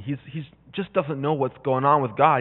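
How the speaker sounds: normal talking voice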